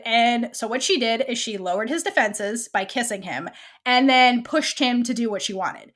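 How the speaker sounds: ordinary delivery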